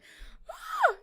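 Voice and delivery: loud high gasp; sharp gasp. Real, not acted. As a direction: gasp in sudden surprise. Sudden realization.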